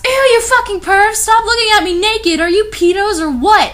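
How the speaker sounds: high, loud disgusted shriek; screeching in disgust; all-caps scream. Acted. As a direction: scream it at the peeping creeps. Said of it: "this is all in caps".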